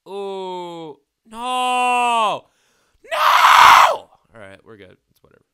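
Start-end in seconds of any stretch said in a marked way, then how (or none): 0.1-4.1 rising to a scream; real alarmed yell, much louder and higher; panicked shout. Real, not acted. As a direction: shout in panic as the TNT goes. Game mishap with TNT.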